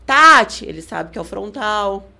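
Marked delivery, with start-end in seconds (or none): 0.0-0.6 one loud, high code word; demonstrated corner call. Shown as a demonstration. Showing a code word for a frontal kick, loud corner call. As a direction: call out a short cue. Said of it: "já vou falar".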